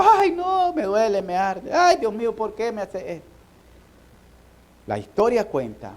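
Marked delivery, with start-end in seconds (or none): 0.0-3.3 higher pleading voice of a burning victim, fading at the end; mock cry of pain. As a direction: whimper out the pain in a lightly raised voice. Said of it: "cuando le estaban pegando el fósforo". (queja de dolor imitada, voz más aguda)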